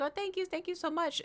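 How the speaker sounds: high-pitched retold thanks; imitated polite gushing. Acting out her own thanks again.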